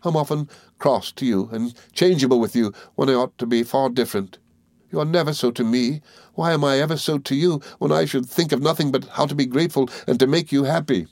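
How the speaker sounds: understated tearful outpouring; sobbing. A narrator's reading. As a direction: pour out guilt with a touch of sobs. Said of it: "she sobbed".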